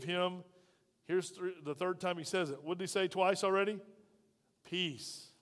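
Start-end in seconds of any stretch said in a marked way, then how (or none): 4.6-5.3 soft peace. Acted. Jesus's word voiced.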